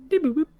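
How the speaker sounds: high, loud beeps; electronic beep imitation. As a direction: chirp like a machine. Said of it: "this acknowledgement beep".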